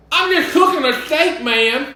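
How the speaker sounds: high, loud, fed-up protest; exasperated. Real, not acted. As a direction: throw up your hands at the critics. Exasperated reply to imagined critics.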